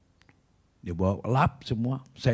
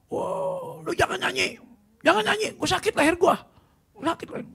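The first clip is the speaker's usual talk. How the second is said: very high, strained squeal of protest; mock pained yelling. Troublemaker begs the singer to stop, high pained mock squeal. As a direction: imitate a man squealing in neck pain. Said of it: "kepegang leher dia".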